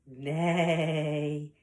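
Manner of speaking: long, wavering horse neigh. Acted. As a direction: imitate a horse, stretching out the neigh. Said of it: "A horse says".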